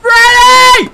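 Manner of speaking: high, loud calling out for Freddy; frantic call. Real, not acted. Gamer calling his companion Freddy for rescue. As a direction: yell for help.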